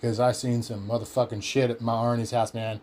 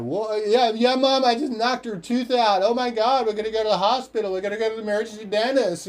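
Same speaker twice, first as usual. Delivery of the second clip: much higher and fast, panicked explaining; 1 frantic high voice; 8 semitones higher than usual. Retold or imitated. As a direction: rush it out, high and breathless with panic. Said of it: "I was like".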